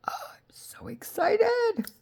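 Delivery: high, breathy squeal of excitement. Real, not acted. Crafter gushing to viewers about her journal, squealing. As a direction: squeak it out, barely holding it in.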